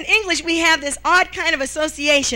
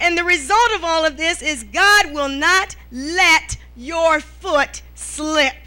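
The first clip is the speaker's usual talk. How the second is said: ordinary talk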